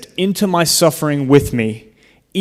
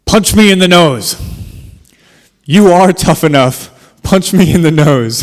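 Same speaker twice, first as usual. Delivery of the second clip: higher and louder, insisting "Punch me in the nose"; retold mom urging her son to punch her, louder; mom's loud challenge; 5 semitones higher, 6 dB louder than usual. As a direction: dare the kid to hit you, loud and firm. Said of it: "and then she said".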